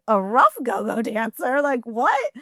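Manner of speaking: disbelieving question, rising at the end; retold bafflement, pitch climbing on "what"; incredulous echo. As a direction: repeat it back as if you can't believe it. Echoes the phrase in disbelief.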